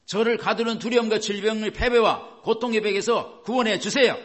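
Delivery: acted fervent prayer, insistent pleas; imploring prayer. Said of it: "이렇게 기도한 것 비슷한 겁니다".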